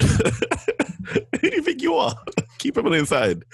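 high, laughing mock fury; laughing mock rant. Laughing at his friend while mock-ranting.

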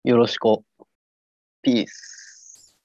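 lame peace sign. Acted. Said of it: "ダサ".

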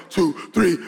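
ordinary talk

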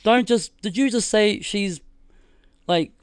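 pitched well up, starting loud; re-enacted excited disbelief; retold incredulous questioning. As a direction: demand confirmation of amazing news. Acts out checking the big news.

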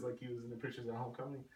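ordinary talk, nothing marked

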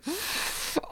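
high breathless gasp of awe; sharp gasp of wonder. Retold or imitated. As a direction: gasp as if stunned by beauty. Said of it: "I watched it and I was like".

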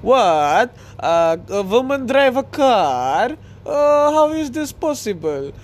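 hammed-up Arabic accent climbing high in astonishment; mock Arabic accent. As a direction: play a baffled foreigner with a thick Arabic accent. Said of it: "like that accent".

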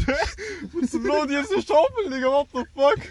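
very high, loud mock bafflement; acting out total confusion at high pitch; incredulous outburst. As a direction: squeal it in disbelief. Acts own baffled reaction, laughing.

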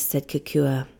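ordinary delivery

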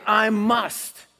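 loud, high, emphatic declaration; definitive conviction. Acted. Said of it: "they talk like this".

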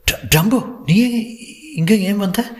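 high-pitched, stammering shock; startled. A narrator's reading. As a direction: stumble on the name, pitch jumping in alarm. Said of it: "தூக்கி வாரி போட்டது". (திடுக்கிட்டு திக்கித் திணறி, உயர்ந்த குரலில்)

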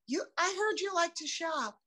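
high, light imitation of Michael; imitating Michael. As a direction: lightly voice Michael asking gently. Retelling what Michael said.

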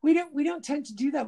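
ordinary talk, nothing marked

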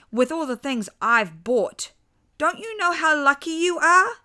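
put-on high, loud scolding in a girl's pitch; indignant child voice. A narrator's reading. Father in girl's body scolding daughter, high indignant voice.

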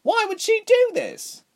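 high, drawn-out cry of dismay; distressed cry. A narrator's reading. Reshma questioning Ilse's leaving, high distressed cry. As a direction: wail the question in disbelief. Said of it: "Reshma cried".

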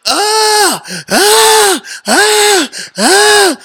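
high, loud mock groans of pain; imitated pain cries. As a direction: cry out like a man flat on the floor with a hurt back. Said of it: "this guy's on the ground like".

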